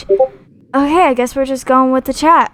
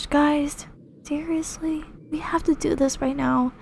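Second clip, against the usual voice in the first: urgent whisper; 5 semitones higher, 6 dB quieter than usual. Real, not acted. Urgent hushed plea to viewers.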